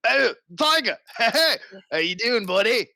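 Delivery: gleeful mock greeting; excited greeting. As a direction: greet a pet with delight. Greeting tiger joyfully.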